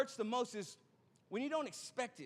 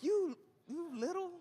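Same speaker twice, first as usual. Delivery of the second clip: high, drawn-out indignant sputter; playfully outraged, slow and high-pitched; mock exasperation; 8 semitones higher than usual. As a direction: draw it out high, as if stopping short of an insult. Mock outrage at the mechanic in story.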